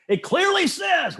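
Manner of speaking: high, slow, laughing mock of a Bible-thumper; mocking preachy quote. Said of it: "Darren Begley was the one that said".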